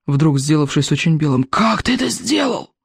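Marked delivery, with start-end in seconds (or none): 1.4-2.7 bleating disbelief. A narrator's reading. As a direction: bleat it out in shock. Голос библиотекаря. (проблеять высоко и медленно, в ужасе)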